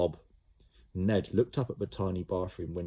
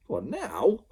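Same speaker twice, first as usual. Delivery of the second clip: surprised question; 8 semitones higher, 4 dB louder than usual. A narrator's reading. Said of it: "asked the boy".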